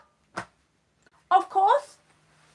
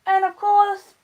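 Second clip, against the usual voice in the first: usual speaking voice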